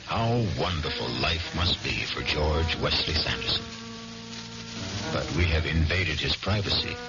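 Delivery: ordinary talk, nothing marked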